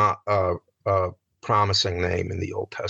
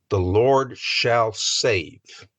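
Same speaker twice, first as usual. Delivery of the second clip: drawn out, each word weighed; slow it right down; slow solemn reading; much slower than usual. Shown as a demonstration. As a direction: reverent slow meaning of a name. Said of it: "what is the name of".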